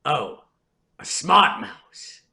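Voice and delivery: slow, deliberate imitation of mom; unhurried mock-impressed comeback; mother's deadpan retort. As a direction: draw it out with dry sarcasm. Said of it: "my mother goes".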